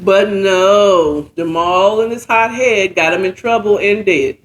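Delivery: usual speaking voice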